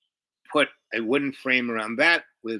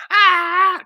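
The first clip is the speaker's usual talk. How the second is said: short, loud, high-pitched imitated cry; mock wail; 12 dB louder than usual. Woman before being offered a place to stay, mock wail. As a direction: cut a hammed-up bawl off short. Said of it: "went from, like".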